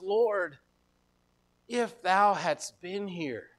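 hushed, slow lament of a mourner; hopeless. Retold or imitated. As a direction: read it softly and slowly, without hope. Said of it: "There's a lingering hopelessness in the story".

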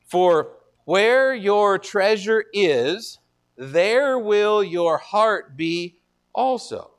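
very slow, each word weighed; slow scripture reading. Shown as a demonstration. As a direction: read the verse slowly and deliberately. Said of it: "let me just read it".

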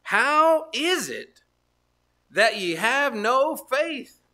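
high, drawn-out rebuke; slow pleading rebuke. Acted. Preacher voicing Jesus questioning his disciples' faith. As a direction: plead it slowly, rising.

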